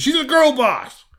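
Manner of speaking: very high, loud cry; hyped-up exclamation. Real, not acted. Storyteller explains the heroine's boldness, exclaiming. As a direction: burst out with mock-triumphant emphasis. Narrator hypes his heroine.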